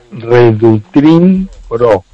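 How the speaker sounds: slow, careful product name; slow dictation. Shown as a demonstration. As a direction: spell it out slowly. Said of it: "P R O".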